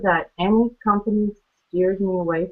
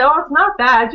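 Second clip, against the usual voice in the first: breezy brush-off; 7 semitones higher, 6 dB louder than usual. Retold or imitated. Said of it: "And I'd say".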